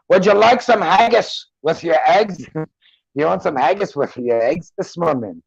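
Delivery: lilting Scottish accent, attempted on request. Shown as a demonstration. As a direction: offer breakfast like a Scot. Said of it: "Try to say something in the Scottish accent".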